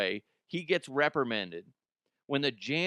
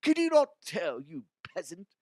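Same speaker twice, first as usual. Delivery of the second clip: snooty museum staff; 8 semitones higher, 4 dB louder than usual. Retold or imitated. Museum scolding the janitor, high snooty mock voice. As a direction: look down on the janitor. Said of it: "They're like".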